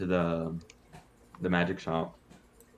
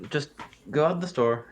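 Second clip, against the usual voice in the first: halfling voice; 7 semitones higher than usual. Acted. Halfling gives directions.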